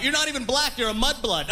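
high-pitched accusing heckle; mock yell. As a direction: imitate someone calling out from the back. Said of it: "from the back yelled out".

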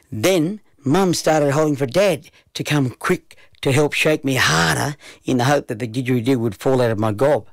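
plain reading voice

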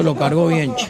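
ordinary talk

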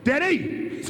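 loud, rising cry of his son's name; calling out. Retold or imitated. Father calling to his son during the X-ray, shouting. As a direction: shout "Denny!" to rouse him.

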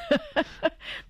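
high, soft chuckle; soft burst of amused laughter. Real, not acted. Broma sobre el San Francisco. (risita aguda y suave)

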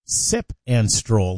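Sip and Stroll slowed right down, each word spaced and clear; careful slow. Shown as a demonstration. Radio host spelling out the event name for listeners, slow and careful. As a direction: say it carefully so it can't be misheard. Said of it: "Gotta be very careful how you say that".

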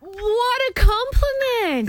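high, slow gushing. Real, not acted. Cohost gushing over the caller's flattering story, high and slow. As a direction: stretch it out, gushing.

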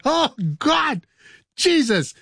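high alarmed cries; mock panic. Host imitating the band in the fire, mock panic. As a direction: cry out as if the fire is real.

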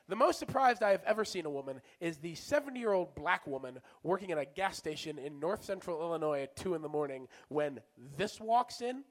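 ordinary talk, nothing marked

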